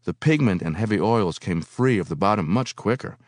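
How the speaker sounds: ordinary delivery, nothing marked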